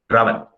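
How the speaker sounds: "rábano" said as raBAno; wrong middle stress. Shown as a demonstration. Teacher rejecting a wrong stress, "rábano" stressed on BA. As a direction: lean on the middle syllable of "rábano". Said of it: "no es".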